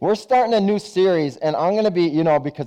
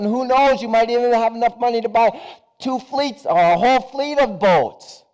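higher, fast, building salesman pitch; eager sales pitch; 7 semitones higher than usual. Acted. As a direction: sell the dream of a fleet. Entrepreneur pitches getting rich.

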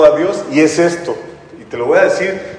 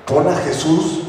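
normal talking voice

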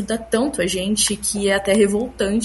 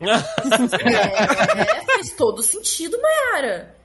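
laughing friends; 2 semitones higher, 4 dB louder, much slower than usual. Retold or imitated. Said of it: "todas as minhas amigas assim".

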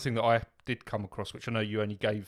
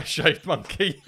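slowly laughing out the words; 9 semitones higher, 6 dB louder, slower than usual. Real, not acted. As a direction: break into laughter. Cracks up asking the question.

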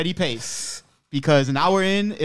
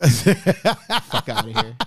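laughing; 4 semitones lower, 3 dB louder, much slower than usual. Real, not acted. Laughing dismissal of fake Italian.

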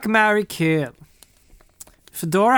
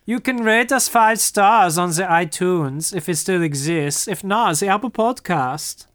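put-on German accent; bad German accent. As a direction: say it as a comic German. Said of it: "this really bad German accent".

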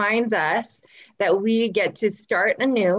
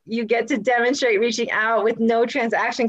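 ordinary talk

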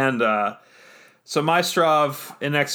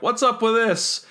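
much higher, puzzled what's-this; puzzled question; 9 semitones higher than usual. Acted. Exegol asks Maestrov about the billboard, high and puzzled. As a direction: ask it high and baffled.

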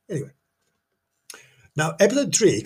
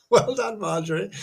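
normal talking voice

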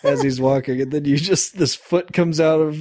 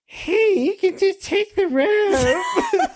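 silly dub voice; much slower than usual. Retold or imitated. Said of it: "he was like".

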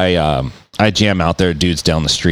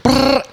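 short rolled brrr of a machine; high trilled burst; imitated noise. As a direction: mimic a drill's rattling buzz. Imitating noise heard outside.

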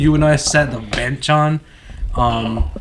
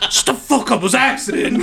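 laughing denial; 8 semitones higher, 3 dB louder than usual. Retold or imitated. Friend quoted, laughing denial.

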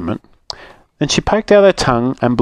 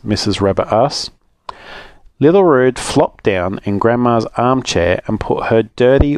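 usual speaking voice

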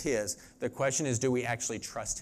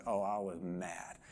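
slow, low and much softer; low-key retold admission; 8 semitones lower, 9 dB quieter than usual. Preacher retelling his evening confession, low quiet admission. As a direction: drop right down and own up slowly.

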